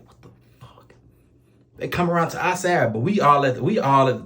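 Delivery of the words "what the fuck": whispered thought. Retold or imitated. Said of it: "I'm like".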